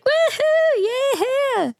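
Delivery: high whooping cheer; excited cheer. Retold or imitated. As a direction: let out a big happy whoop.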